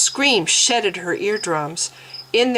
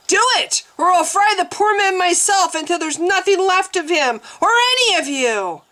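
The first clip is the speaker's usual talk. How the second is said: furious threat; 11 semitones higher than usual. A narrator's reading. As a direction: threaten them with fire. Threatening attendants with fire.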